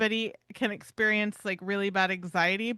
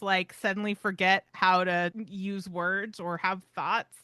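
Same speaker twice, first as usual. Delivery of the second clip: ordinary talk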